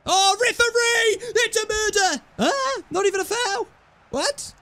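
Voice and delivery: high, hammed-up outrage at the ref; mock outrage. Gamer protests to the referee, hammed-up high outrage. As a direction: protest the foul like a betrayed fan.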